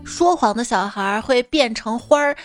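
usual speaking voice

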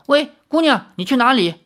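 high, urgent call to stop her; calling after someone. A narrator's reading. Hurriedly calling after departing woman.

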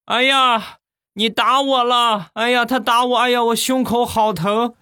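mock high-pitched cry of pain; loud outcry. Old man on the ground accusing passer-by, loud high outcry. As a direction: wail like a hammed-up injured old man. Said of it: "大声地喊".

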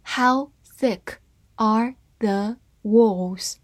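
slow, word-by-word model reading. Shown as a demonstration. Teacher reads the example sentence for learners, slowly word by word. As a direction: read it slowly, separating each word. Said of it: "慢慢来读".

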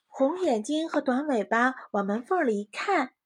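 ordinary delivery

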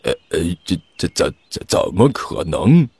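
flustered stammer. Acted. Father caught out, soft stammering denial.

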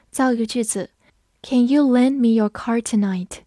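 usual speaking voice

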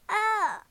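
short high "ah" of alarm; startled cry. A narrator's reading. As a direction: cry out as if losing balance. Princess wobbles on the stone.

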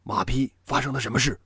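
quick, gravely voiced demand; stern, grave questioning. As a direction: question a subordinate sternly and briskly. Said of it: "沉声问道". (沉声追问)

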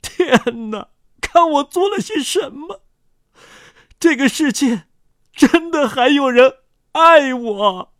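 high-pitched theatrical sobbing; sobbing outcry. A narrator's reading. Remorseful convict sitting on the ground, sobbing. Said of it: "那罪犯失声痛哭".

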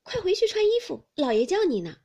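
high, loud hurrying call; urgent summons. A narrator's reading. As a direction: rush him off with pressing news. Maid urgently summons Baoyu to father.

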